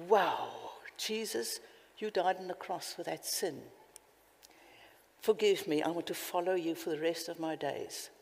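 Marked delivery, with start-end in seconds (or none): none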